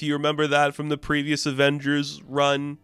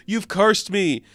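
mock villain rant; 7 semitones higher, 4 dB louder than usual. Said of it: "just screaming at the top of his lungs".

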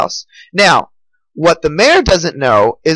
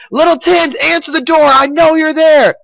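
high, insistent call through a door; infuriated mayor; 9 semitones higher than usual. A narrator's reading. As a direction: act out angrily demanding to be let in. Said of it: "mayor's just beating at the door".

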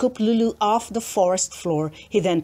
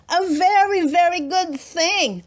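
put-on a bright child's echo, much higher; eager girl; 8 semitones higher than usual. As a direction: squeal it back with glee. Lulu echoes eagerly.

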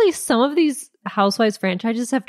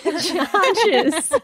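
laughing New Jersey "charges"; mock Jersey drawl on charges; New Jersey accent; 7 semitones higher than usual. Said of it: "In your New Jersey accent".

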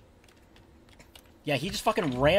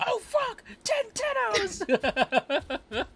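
mock panicked shout; 5 dB quieter, much slower than usual. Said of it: "watch me be like".

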